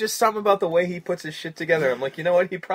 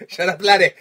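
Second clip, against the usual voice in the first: put-on Scottish accent; 4 semitones higher, 10 dB louder than usual. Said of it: "The fuck?".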